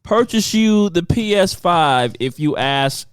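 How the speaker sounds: slow, drawn-out question; slow host voice. Acted. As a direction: ask it like a game show host. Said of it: "We need answers".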